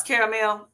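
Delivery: caramel in three full syllables, ending in "mel". Shown as a demonstration. Telling viewers how it is pronounced, caramel said CARE-uh-mel. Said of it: "Yeah, I say caramel".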